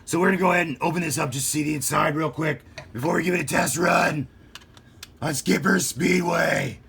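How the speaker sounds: Pete Holmes Batman. Retold or imitated. Unboxing the Batmobile for kids, as a hammed-up Batman. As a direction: play a boastful cartoon Batman. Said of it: "it's really hard to talk like that".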